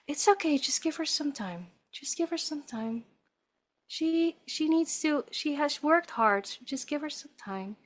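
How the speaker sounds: calm the new mother, quietly; soothing midwife. Retold or imitated. Midwife reassuring the mother about the baby, soft soothing voice. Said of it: "She said".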